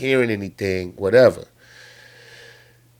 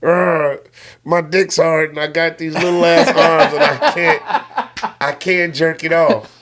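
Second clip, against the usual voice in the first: raised-pitch mock dinosaur groaning; complaining T. rex; 5 semitones higher than usual. T. rex griping to the rabbit, comic frustrated voice. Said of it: "He was like".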